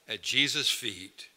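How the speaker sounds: usual speaking voice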